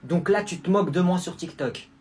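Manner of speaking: normal talking voice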